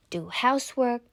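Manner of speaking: ordinary delivery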